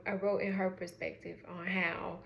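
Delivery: usual speaking voice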